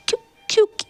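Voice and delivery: crying. A narrator's reading. Sister weeps over her mother's cruelty, crying. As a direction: cry out the question, hurt and lost. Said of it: "Yanli ऐसे ही बस रोए जा रही थी".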